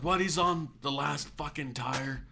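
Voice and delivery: high-pitched retold outburst; wigging out. Impatient customer wigging out at the mechanic over his tires, imitated. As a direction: imitate a customer losing it in disbelief. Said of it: "just starts wigging out".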